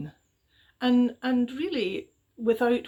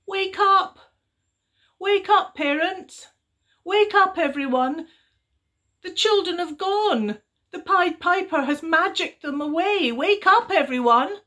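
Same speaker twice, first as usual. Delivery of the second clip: loud high urgent cry to wake the village; shouting; 9 semitones higher, 9 dB louder than usual. A narrator's reading. Little girl shouting to wake sleeping parents, loud and high. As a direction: call out at the top of her voice. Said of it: "and she shouted".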